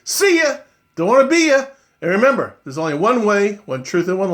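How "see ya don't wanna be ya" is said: jokey sign-off. Real, not acted.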